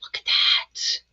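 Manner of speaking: soft unvoiced whisper; hushed. Real, not acted. Whispering to viewers as the ink reacts. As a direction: whisper it in quiet awe.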